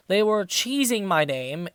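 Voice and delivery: ordinary talk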